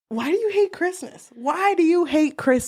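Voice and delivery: high, shocked pleading question; can't believe anyone hates Christmas; incredulous cowboy. Retold or imitated. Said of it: "is like".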